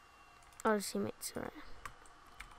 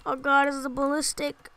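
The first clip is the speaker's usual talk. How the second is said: normal talking voice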